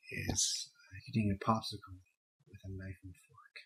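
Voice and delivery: quiet, swollen-faced mumble; with a sore jaw. Real, not acted. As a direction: talk through a swollen sore face. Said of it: "The entire front of my face is, is swollen".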